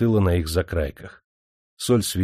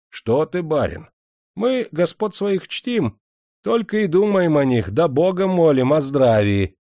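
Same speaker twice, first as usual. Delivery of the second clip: higher, slow, innocent-sounding peasant voice; sly mock humility; 7 semitones higher, 4 dB louder than usual. A narrator's reading. Worker feigns devotion to his master, sly. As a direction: play the simpleton while mocking him. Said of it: "с простоватой хитрецой сказал соливар".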